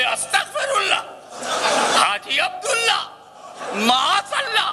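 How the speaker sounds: high, laughing flustered excuse; nervous laughing. Acted. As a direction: laugh it off, caught out. Cleric caught, nervous laugh. (घबराई हँसी के साथ ऊँची आवाज़)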